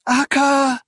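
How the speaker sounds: high, loud call after someone leaving; desperate high-pitched cry. A narrator's reading. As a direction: call the name out with abandon. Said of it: "she cried out, not caring who heard".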